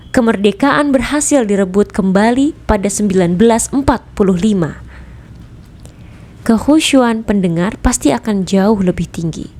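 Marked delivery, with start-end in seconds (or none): none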